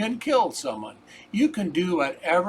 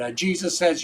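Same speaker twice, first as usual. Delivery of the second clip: normal talking voice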